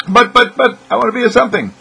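put-on high, loud childish protest; stammering high whine of but, but, but; whiny pleading listener. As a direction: beg like a hurt kid who wants to matter. Imagined listener pleads back.

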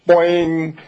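slow, high, loud cartoon boing. Hammer hitting the head, a loud cartoon boing. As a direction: sound the hammer bonk like a cartoon.

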